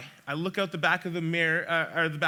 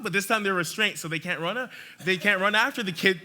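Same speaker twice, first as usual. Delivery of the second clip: ordinary talk, nothing marked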